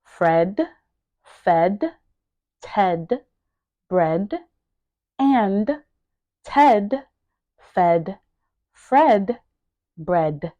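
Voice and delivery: one word at a time, clearly separated. Shown as a demonstration. Teacher modelling each word for learners to repeat, word by word. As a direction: leave a gap after every word. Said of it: "make sure that you are pronouncing each word".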